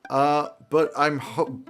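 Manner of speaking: ordinary talk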